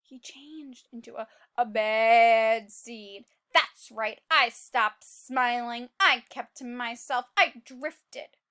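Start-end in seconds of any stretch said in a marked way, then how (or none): 1.6-3.2 "bad seed" drawn out slowly and low; dramatic reveal. A narrator's reading. Teacher revealing the seed has turned bad, slow dramatic stress. 4.3-8.4 low, slow, brooding read-aloud; gloomy narration. A narrator's reading. Seed describing his lonely bad life to listeners, gloomy narration.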